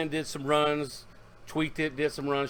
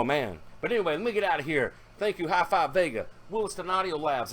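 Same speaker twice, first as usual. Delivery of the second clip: normal talking voice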